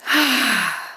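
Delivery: long, open sigh; demonstrated full sigh of release; big releasing sigh. Shown as a demonstration. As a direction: let it all out in a big sigh. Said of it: "releasing sigh. So we're gonna go".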